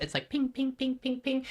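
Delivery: high pitched ping ping ping, like sonar; ping it out high like a signal going out. Shown as a demonstration. Medium showing the host her echolocation, high pings. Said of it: "but echolocation".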